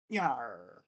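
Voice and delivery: low, soft mock pirate yar; quiet, badly faked pirate yar; horrible pirate. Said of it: "My horrible pirate voice".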